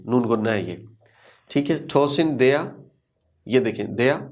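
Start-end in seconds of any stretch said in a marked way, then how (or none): 1.9-2.7 tossing their with nasal ng and no R; silent G and R. Shown as a demonstration. Teacher showing silent letters, nasal ending. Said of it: "टॉसिंग का जी नहीं पढ़ा". 3.9-4.2 their said "dea", no R; silent R. Shown as a demonstration. Teacher demonstrating to learners, R-less. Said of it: "देयर का आर नहीं पढ़ा".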